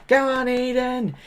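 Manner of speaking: high, hammed-up cheering for a child; mock cheer. Vlogger mock-cheering the pageant girl, high and hammy. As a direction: cheer her on in a silly high voice.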